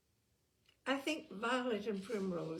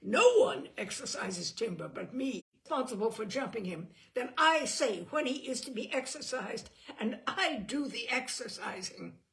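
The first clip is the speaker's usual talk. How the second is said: loud furious claim of ownership; angry; 5 semitones higher, 7 dB louder than usual. A narrator's reading. Simon shouting down Brat over his horse, furious. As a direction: lay down the law, loud and hot.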